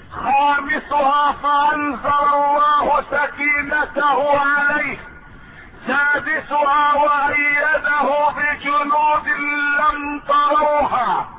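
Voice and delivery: high, ringing sermon declamation; high-pitched oratorical recitation; full-force preaching. Acted. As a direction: proclaim each point to the congregation, voice held high. Preacher enumerates the verse's parts.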